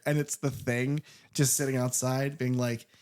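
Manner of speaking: ordinary talk, nothing marked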